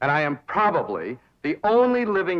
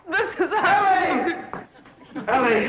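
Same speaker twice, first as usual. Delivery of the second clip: high, loud, lovestruck and slow; ecstatic cry; 5 dB louder than usual. Acted. Smitten man calls out arriving for Ellie, ecstatic. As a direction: sing out her name like a swooning lover. Entranced suitor bursts in.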